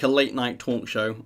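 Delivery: usual speaking voice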